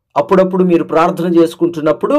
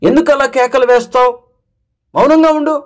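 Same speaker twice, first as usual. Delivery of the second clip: higher, sharper scolding from the crowd; 7 semitones higher than usual. Acted. Crowd tells the shouting blind man to hush, sharp scolding. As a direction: tell him off to be silent. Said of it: "అంటున్నారు".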